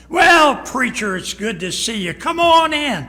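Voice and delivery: loud, slow, high false welcome; husband covers the fighting with a loud welcome; fake hearty shout. Fighting husband greets preacher.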